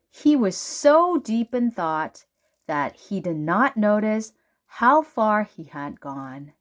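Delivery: usual speaking voice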